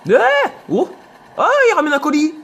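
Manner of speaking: high, loud excited cry; excited shout. Real, not acted. Gamer spotting an enemy with a package, excited loud shout. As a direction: yell out at the sudden target.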